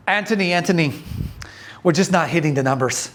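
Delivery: put-on lightly done Lebanese accent; a friend's Lebanese accent, gently imitated; Nader's Lebanese accent. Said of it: "I'll do my best to speak with a Lebanese accent".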